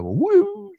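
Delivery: high squeaky little woo; mock cheer. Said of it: "I go".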